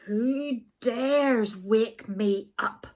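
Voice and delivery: lightly voiced grumpy bear; angry bear growl. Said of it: "growled the bear angrily".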